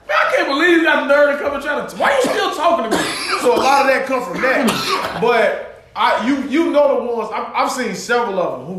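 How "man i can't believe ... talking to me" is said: high, quick, exasperated mock rant; hurried angry disbelief, pitch climbing; acted anger. Voicing anger at someone who keeps talking, exasperated. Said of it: "the anger comes from you like".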